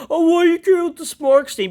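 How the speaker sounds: high, loud mocking whine; mocking whiny fan. Ranting vlogger mocks angry wrestling fans, high whiny voice. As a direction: sneer at the angry fans in a silly voice. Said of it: "all the Smarks are angry".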